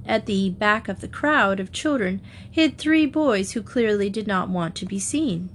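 ordinary delivery

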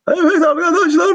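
high flanged announcer voice. Real, not acted. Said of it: "flanger deniyorum hala".

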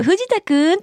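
high call of his name; calling Fujita high, the way Hana does; imitating Hana. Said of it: "花さんの真似して".

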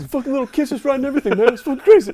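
freaking-out Sal. Retold or imitated. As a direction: squeal it like Sal panicking. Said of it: "Sal was freaking out".

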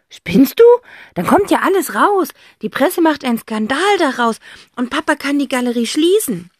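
put-on loud girl's rebuke; scolding sister. A narrator's reading. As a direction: snap at the brother.

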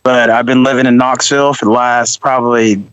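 ordinary delivery

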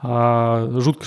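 ordinary talk, nothing marked

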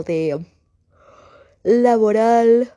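real yawn through the word; word swallowed by a slow yawn. Real, not acted. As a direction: stretch the word out loud in a yawn. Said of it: "perdón el bostecito".

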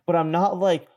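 ordinary talk, nothing marked

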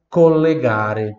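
slow, loud collegare; slow model word. Shown as a demonstration. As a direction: say collegare syllable by syllable. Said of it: "Repita em voz alta". (collegare pronunciado devagar)

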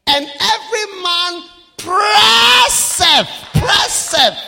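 slow, loud, drawn-out preaching cry; preaching shout. Real, not acted. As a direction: belt it out to the congregation. Preacher shouting scripture phrase.

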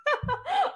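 quick, very high rising laugh; high giggle. Real, not acted. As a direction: let a squeaky laugh slip out. Still laughing at herself.

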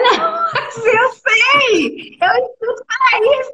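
high cry of protest, half laughing; tearful protest. Retold or imitated. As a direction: plead insistently through tears. Elle crie en pleurant au père.

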